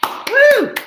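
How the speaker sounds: high whooping cheer; excited cheer. Real, not acted. Host cheering the author after the reading, excited whoop. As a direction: whoop with delight.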